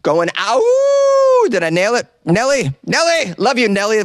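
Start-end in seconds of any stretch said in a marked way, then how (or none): 0.4-1.5 sharp high shouted ow. Retold or imitated. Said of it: "That was my impression of [name]". 2.2-4.0 fond calling. Real, not acted. Calling fondly to the reviewer.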